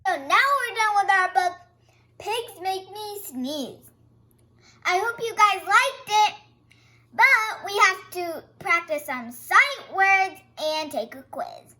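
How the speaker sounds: ordinary delivery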